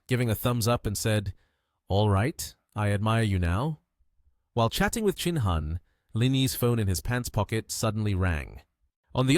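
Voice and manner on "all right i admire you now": plain reading voice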